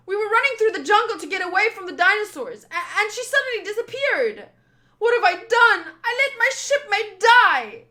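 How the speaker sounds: high, loud, frantic outpouring; panicked confession. Acted. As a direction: wail with guilt and horror. Panicked confession of losing shipmate.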